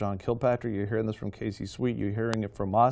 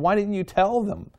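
higher, pleading question from the Lord; the Lord asking; 7 semitones higher, 4 dB louder than usual. Retold or imitated. The Lord questioning believers, pitched higher and pleading. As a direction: voice the Lord's grieved question, lifted up. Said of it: "And the Lord was going".

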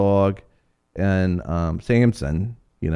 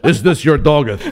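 mock heroic Superman; 5 semitones higher, 5 dB louder than usual. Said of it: "like Superman throws his chest out".

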